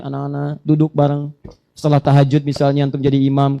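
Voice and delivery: ordinary talk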